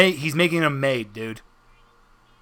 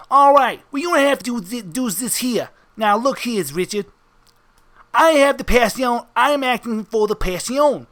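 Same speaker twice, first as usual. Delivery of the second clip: higher-pitched wiseguy, sizing someone up; mobster henchman; 6 semitones higher than usual. Acted. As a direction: play a comic mob heavy addressing a newcomer. Game master plays mobster henchman.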